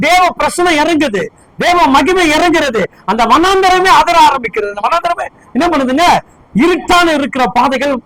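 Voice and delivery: high, driving sermon delivery; impassioned preaching. Real, not acted. Preacher telling of God's presence descending on the wilderness, high impassioned preaching. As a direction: preach it with mounting fervour, pitched high.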